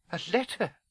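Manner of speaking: high, faint echo; small high voice, taken aback; faint disbelief. A narrator's reading. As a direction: repeat it weakly, as a stunned question. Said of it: "repeated Professor McGonagall faintly".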